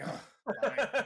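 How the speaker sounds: a hammed-up exasperated Kova. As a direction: give in with a huff. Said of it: "they're like".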